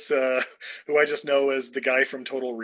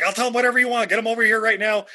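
high, louder mock snitch blurting it out; pitched-up pleading informant impression; eager informant; 7 semitones higher, 4 dB louder, faster than usual. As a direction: imitate a witness desperate to cooperate. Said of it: "he was just singing like a fucking canary".

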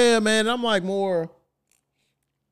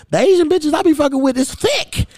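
high, loud indignant comeback; retold heated retort; 12 semitones higher, 4 dB louder than usual. Telling his date off, high and loud. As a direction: fire it back at her, pitched way up. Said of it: "I said".

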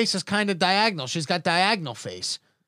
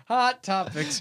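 slow, drawn-out announcer call; segment title; 3 dB quieter, slower than usual. Acted. Host calling out the segment to guests, slow announcer style. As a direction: draw the title out like a game show.

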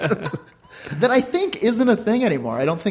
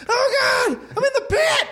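panicked high scream; slower than usual. Pretend. Voicing a trapped screaming victim.

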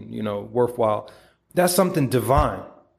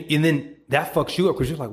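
ordinary talk, nothing marked

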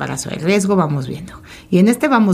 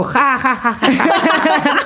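high giggly nervous laughter; 9 semitones higher, much slower than usual. Retold or imitated. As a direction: laugh high and helplessly in disbelief. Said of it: "esa risa nerviosa".

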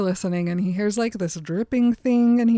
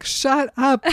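higher, slightly raised retold rebuke; mock scolding; 6 semitones higher, 4 dB louder than usual. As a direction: cut off the imagined howler. Jacob snaps at howling Seth, acted.

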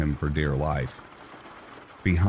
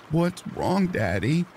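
through tears; 11 semitones higher, slower than usual. A narrator's reading. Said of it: "Annie said through tears".

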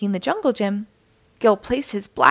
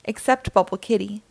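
usual speaking voice

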